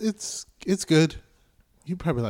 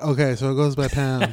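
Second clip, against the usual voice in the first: imitating her; 7 dB louder than usual. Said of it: "That's her voice, right?".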